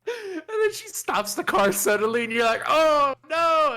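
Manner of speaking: high, loud cry of dread; playacted kid realizing doom is coming; mock panic. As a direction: blurt it out in hammed-up alarm. Acted dread as mom stops car.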